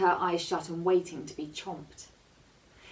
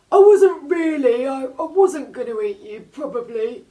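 high, loud, odd cartoon shark voice; a big shark owning up; sheepish shark; 9 semitones higher, 15 dB louder than usual. A narrator's reading. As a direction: shark admitting it was wrong to the girl.

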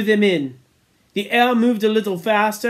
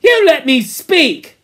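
slow, high, loud demand; Piggy demanding his turn; indignant; 9 semitones higher, 9 dB louder than usual. Acted. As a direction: plead angrily to be heard. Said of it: "said Piggy indignantly".

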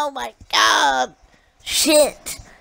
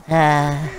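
ordinary delivery

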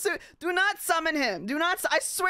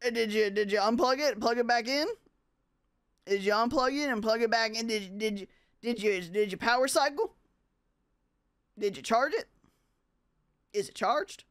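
lower, mock American country accent; 4 semitones lower than usual. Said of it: "Do you like my country accent?".

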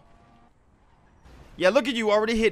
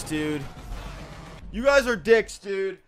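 ordinary talk

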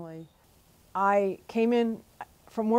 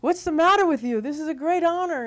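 high, loud exasperated scolding, retold; imitated frustration; 9 semitones higher, 6 dB louder than usual. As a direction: act out a frustrated priest telling someone off. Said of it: "he got really frustrated with me".

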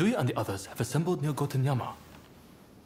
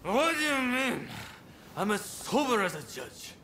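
put-on slurred, swaggering drunk bragging; drunken boasting; 8 semitones higher than usual. Drunk man pestering a stranger about his techniques, slurred swagger. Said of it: "He's three sheets to the wind".

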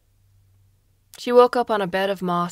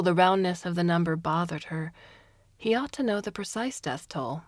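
plain reading voice

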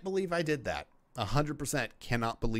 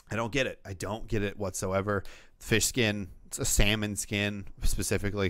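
ordinary delivery

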